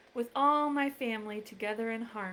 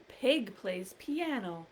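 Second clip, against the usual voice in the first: ordinary delivery, nothing marked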